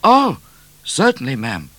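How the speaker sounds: high, startled, falling away at the end; gasped reply. A narrator's reading. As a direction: answer the Queen as if taken aback. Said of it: "gasped Midnight".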